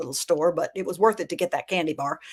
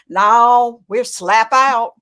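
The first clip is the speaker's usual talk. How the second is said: drawn-out, louder storekeeper answer; storekeeper's reply; 7 dB louder, much slower than usual. Retold or imitated. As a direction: draw out the no, we're slap out slowly.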